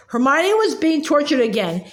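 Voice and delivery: ordinary delivery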